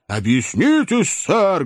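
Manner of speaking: high, drawn-out wrathful demand; angry cry. A narrator's reading. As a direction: cry out angrily, pitch climbing. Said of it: "гневно возопил Боб Сойер".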